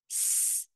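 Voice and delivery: long whispered hiss of স; hissing s sound. Shown as a demonstration. Teacher hisses স to show the whistling letters. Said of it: "স একটা আওয়াজ আসছে".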